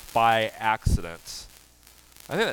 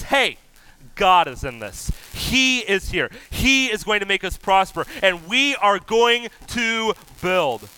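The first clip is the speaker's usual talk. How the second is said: louder, higher, firm proclamation; bold declaration; 6 semitones higher, 6 dB louder than usual. Retold or imitated. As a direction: declare it boldly, no backing down. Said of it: "It's bold".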